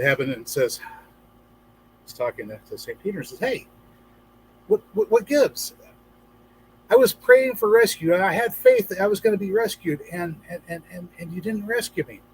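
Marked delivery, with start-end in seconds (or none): 3.4-12.2 indignant complaint. Retold or imitated. Drowned man complains to Saint Peter.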